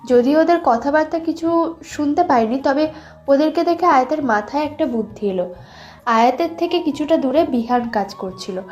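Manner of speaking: ordinary talk, nothing marked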